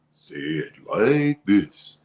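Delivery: slow, drawn-out line reading; coaching producer. Retold or imitated. Producer coaching ads line by line, slow imitated delivery. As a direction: stretch LIKE as a coach modelling delivery. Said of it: "Line by line".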